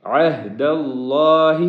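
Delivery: اللہ said with a full, heavy L; slow, stress the heavy اللہ; عہد said from the chest, اللہ heavy and full; heavy Allah. Shown as a demonstration. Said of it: "اللہ کا جو لفظ ہے، اس کو موٹا کرنا ہے".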